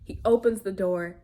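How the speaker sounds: ordinary talk, nothing marked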